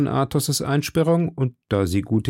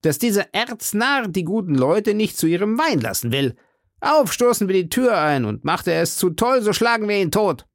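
high, outraged gentleman; worked-up, higher-pitched outrage; indignant threat; 9 semitones higher than usual. A narrator's reading. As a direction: rage at the locked door, voice pitched up. Angry Englishmen threatening in story.

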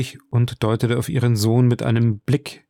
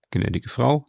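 courteous count. A narrator's reading. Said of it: "antwortete Monte Cristo".

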